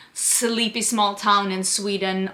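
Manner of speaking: usual speaking voice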